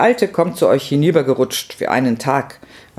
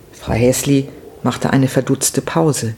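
ordinary delivery

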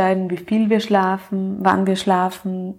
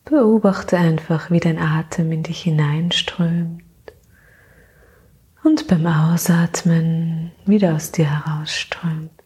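sleep meditation; 2 semitones lower than usual. Acted. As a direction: gently lull her into sleep.

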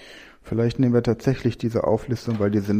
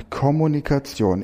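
ordinary talk

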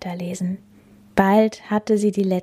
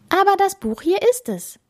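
put-on very high girl's voice; insistent Bella; 14 semitones higher than usual. Bella defending her book to the captain, insistent.

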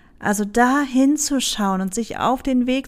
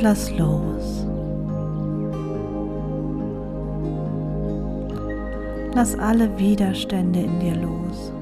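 soft spa whisper; 2 semitones lower, 6 dB quieter than usual. Acted. Leads a meditation over music. (ruhige, langsame Meditationsstimme)

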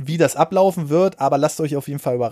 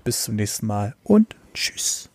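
whispered goodbye; 5 semitones lower than usual. Real, not acted. Quiet goodbye at the end.

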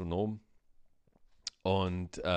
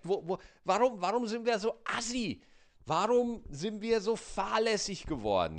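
much higher indignant mock rant; aggro rant; 8 semitones higher than usual. Customer ranting at the bakery clerks, high indignant mock anger. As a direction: vent at the gossiping clerks in mock outrage. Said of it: "Und es macht mich so aggro".

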